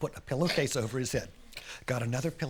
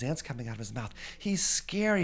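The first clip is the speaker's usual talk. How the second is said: ordinary delivery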